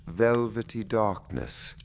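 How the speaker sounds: usual speaking voice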